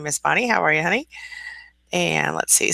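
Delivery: ordinary talk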